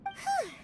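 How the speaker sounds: high, quick puff of relief; relieved whew. Acted. Companion reacting to the wedding schemes, high relieved whew. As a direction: blow out the tension in one high breath.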